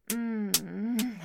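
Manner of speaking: long loud moan. Acted.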